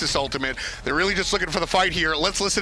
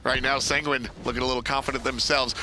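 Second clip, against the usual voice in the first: ordinary talk, nothing marked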